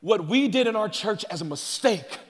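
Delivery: usual speaking voice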